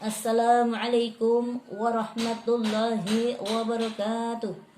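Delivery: slow, steady and firm salam; firm greeting. Shown as a demonstration. Lecturer showing students a firm, plain salam. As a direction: greet the crowd plainly but firmly. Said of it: "Biasa aja, tegas ya".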